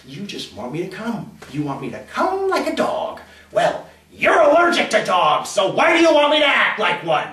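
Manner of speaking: acted irritation building to loud, high protest; indignant, escalating tirade; exasperated rant. Exasperated inner rant at partner.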